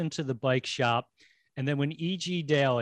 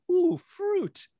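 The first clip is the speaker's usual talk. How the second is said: high-pitched mimicked coo; playful high-voiced quote; imitating the dad. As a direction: put on a high character voice. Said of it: "When his dad simply said".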